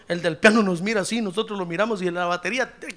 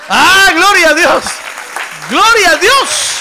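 real high, full-force shout; booming, high worship cry; shouted praise; 10 semitones higher, 14 dB louder, slower than usual. Real, not acted. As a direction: holler praise, drawing out the last cry. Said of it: "termino gritando".

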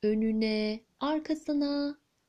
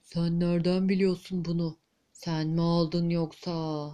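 lower, suspicious giant queen; angry queen; 6 semitones lower than usual. Acted. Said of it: "kraliçe birden hiddetlenmiş".

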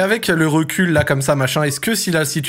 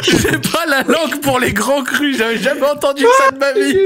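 laughing; 7 semitones higher than usual. Real, not acted. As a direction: repeat the line while cracking up. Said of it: "celle-là, elle est belle".

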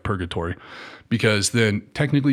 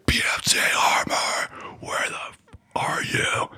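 menacing drill sergeant; much slower than usual. Retold or imitated. Drill sergeant calling him out.

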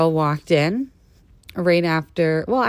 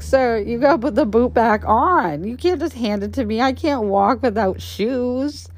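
bossy demand; 8 semitones higher than usual. Retold or imitated. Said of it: "I was like".

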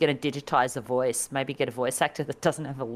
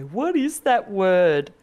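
put-on slow, loud, higher baffled kid; puzzled kid voice; 5 semitones higher, 5 dB louder, much slower than usual. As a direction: drag it out like a confused kid at the arcade. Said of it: "we'd always go".

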